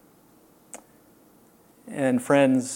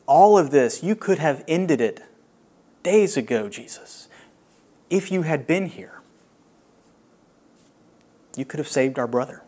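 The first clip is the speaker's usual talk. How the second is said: lament. Acted. Preacher voicing Mary and Martha's grief, lamenting. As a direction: voice the grieving sisters' reproach.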